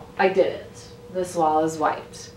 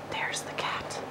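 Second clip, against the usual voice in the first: whisper; 10 dB quieter, slower than usual. Real, not acted. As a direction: whisper it so nobody wakes. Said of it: "Hank is sleeping in there".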